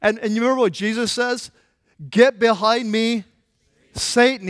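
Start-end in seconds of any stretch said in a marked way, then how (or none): 2.1-4.5 slow, louder, commanding rebuke; stern rebuke. Retold or imitated. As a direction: lay down each word firmly. Jesus rebukes Peter, retold.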